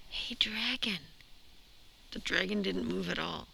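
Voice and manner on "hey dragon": whispered, much softer. Acted. Princess whispering to the sleeping dragon, very softly. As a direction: breathe it so the dragon barely hears. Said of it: "Elizabeth whispered very softly".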